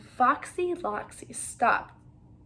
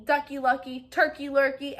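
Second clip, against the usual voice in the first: usual speaking voice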